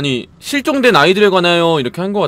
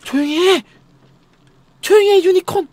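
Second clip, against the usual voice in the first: sharp hush-up shout, much higher; scolding shout; 11 semitones higher than usual. Real, not acted. Gamer yelling at noisy unicorn to hush, scolding shout. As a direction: tell the noisy thing to shut up.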